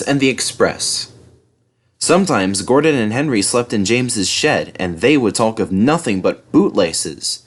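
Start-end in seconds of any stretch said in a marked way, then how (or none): none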